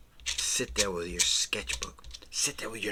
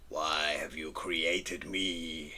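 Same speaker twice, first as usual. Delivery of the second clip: slow, low, whispery creature voice; spooky drawn creature; 4 semitones lower, 4 dB quieter, much slower than usual. Pretend. Drawn bird asking its maker, slow spooky whisper. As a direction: drag it out in a low eerie whisper. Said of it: "he says".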